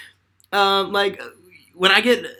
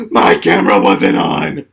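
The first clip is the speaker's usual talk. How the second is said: low, loud dismayed gasp of horror; sudden dismay; mock horror at the missing footage; shocked realization; 11 semitones lower, 7 dB louder, slower than usual.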